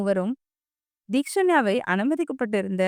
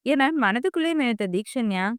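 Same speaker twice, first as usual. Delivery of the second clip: usual speaking voice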